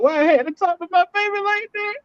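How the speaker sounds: very high-pitched wailing remorse; mock crying. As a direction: whimper like a guilt-stricken softie dad. Acting out his remorseful crying afterwards.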